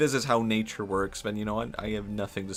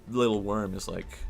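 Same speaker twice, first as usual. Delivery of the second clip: usual speaking voice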